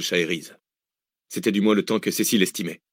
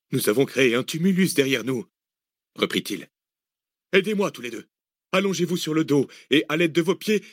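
urgent instructing man, raised pitch; commanding Arsène; 7 semitones higher than usual. Acted. Arsène directing the others to pack the earth, urgent. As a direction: direct them step by step.

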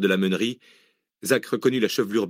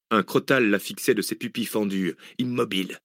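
ordinary delivery, nothing marked

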